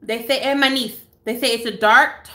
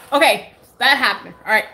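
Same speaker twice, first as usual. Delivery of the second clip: ordinary talk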